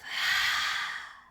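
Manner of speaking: big open-mouthed sigh out; loud sigh. Shown as a demonstration. Teacher showing the class a sigh, loud open-mouthed sigh. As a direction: let it all go through the mouth. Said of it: "Sigh from the mouth".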